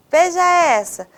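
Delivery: ordinary delivery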